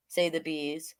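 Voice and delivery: plain reading voice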